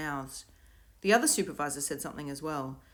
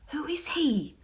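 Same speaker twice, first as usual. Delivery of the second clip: breathy hushed whisper, Davina asking; whispered question; 11 semitones higher, slower than usual. A narrator's reading. Davina asks Will in a hushed whisper. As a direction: keep it to a hush. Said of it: "asked Davina".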